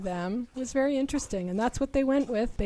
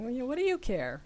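lightly voiced grandparent, pitched higher; dismissive grandparent; 6 semitones higher, faster than usual. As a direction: brush off the question like a grandparent. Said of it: "one of my grandparents saying".

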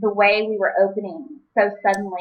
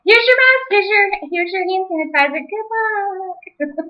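much higher, mock-cheerful handout; sarcastic high voice; 11 semitones higher, 4 dB louder, slower than usual. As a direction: chirp it with fake cheer. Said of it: "like I said".

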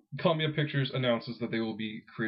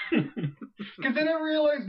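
laughing; 13 semitones higher, much slower than usual. Real, not acted. Laughing at his own idea.

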